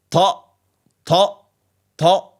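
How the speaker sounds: ta said heavy and emphatic; a heavy, stressed ta. Shown as a demonstration. Teacher demonstrating the emphatic ta. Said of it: "but pronounced heavy".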